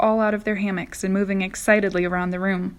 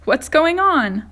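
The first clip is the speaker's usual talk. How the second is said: higher and louder, confused; alarmed cry; 7 semitones higher, 8 dB louder, slower than usual. Acted. James leaping from his hammock, loud bewildered cry. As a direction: call out bewildered. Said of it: "cried James".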